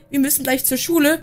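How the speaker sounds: ordinary talk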